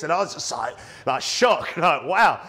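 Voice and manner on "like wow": ordinary talk, nothing marked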